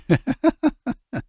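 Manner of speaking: put-on a short high drunken giggle; high tipsy giggle. Rich drunk laughing off his confession, high giggle.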